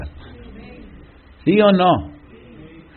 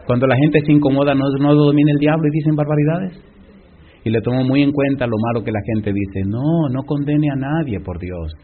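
ordinary delivery

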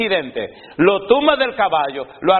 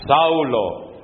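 voice of Jesus; 6 semitones lower than usual. Acted. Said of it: "oyó una voz que le decía".

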